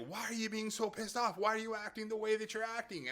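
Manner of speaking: husband snapping at his grouchy wife, mock irritated and high; mock grouchy snap. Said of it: "I could have been really grouchy, like".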